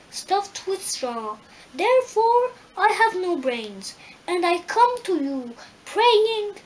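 high, loud eager scarecrow voice; brave scarecrow. Acted. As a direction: plead bravely like a simple straw man. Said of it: "he answered her bravely".

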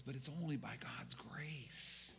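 slow, hushed whisper of humility. Retold or imitated. Pastor voicing Paul's humble confession in a slow whisper. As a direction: breathe it out reverently. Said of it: "He goes".

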